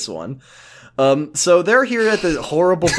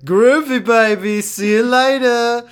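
high, loud, slow groovy dude voice; hammed-up groovy guy; 8 semitones higher, 4 dB louder, slower than usual. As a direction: ham up a retro cool guy, stretched out. Cassidy voiced in retelling.

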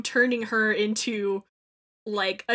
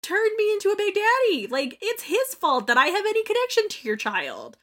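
indignant; 11 semitones higher, 4 dB louder than usual. Acted. Voicing Delta rebutting Sophia.

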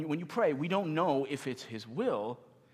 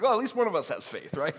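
laughing quip; 4 semitones higher, 5 dB louder, faster than usual. Retold or imitated. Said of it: "I was like".

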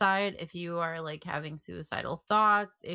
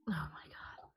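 breathless stunned whisper; barely voiced, whispered in shock; 3 semitones lower, 14 dB quieter than usual. Real, not acted. Co-host whispering in disbelief at the story, hushed whisper.

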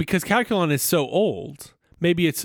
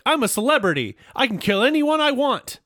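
high-pitched diva outburst; mock celebrity indignation, pitched high; Calculon; 9 semitones higher than usual. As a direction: boast grandly in a raised voice. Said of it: "Calculon's like".

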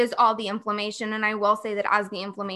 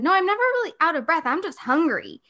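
higher, fast, emphatic denial; insistent protest; 8 semitones higher, 3 dB louder than usual. Retold or imitated. Correcting doctors with a higher insistent protest. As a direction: push back on the doctors. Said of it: "And I said".

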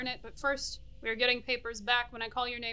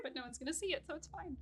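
ordinary delivery

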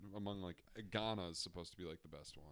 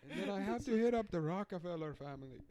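put-on African accent; 7 semitones higher, 3 dB louder than usual. Continuing accent bit.

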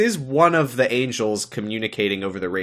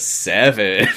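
sultry mock; 2 semitones lower, 3 dB louder than usual.

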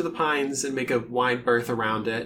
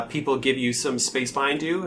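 ordinary delivery

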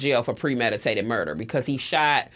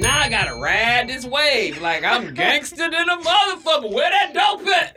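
high, loud mock-gangster holler; gangster bravado; 8 semitones higher, 3 dB louder than usual. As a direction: shout it like you're playing the big gangster. Acting gangster after false rumor.

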